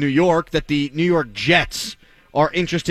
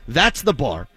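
ordinary talk, nothing marked

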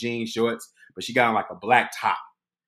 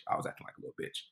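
ordinary delivery

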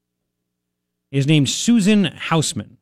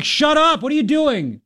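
high-pitched mock scolding; exasperated parent act, pitched right up; scolding dad; 10 semitones higher, slower than usual. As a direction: snap at a noisy child, hammed-up. Dad scolding his screaming four-year-old.

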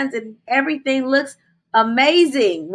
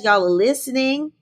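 ordinary talk, nothing marked

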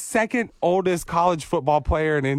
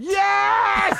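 high excited yell; cheering shout; 13 semitones higher than usual. Real, not acted. Cheering for edible mascot.

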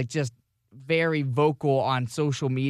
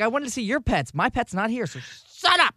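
argumentative; 6 semitones higher than usual. Retold or imitated. Answering a commenter about pets, argumentative. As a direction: build to an exasperated retort. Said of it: "is that Scott in an argument".

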